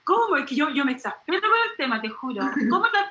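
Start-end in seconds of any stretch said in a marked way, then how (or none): 0.0-0.4 high, loud, slow cry of disbelief; loud incredulous outburst; real exasperated shout. Real, not acted. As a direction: shout it out, fed up. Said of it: "estoy gritando".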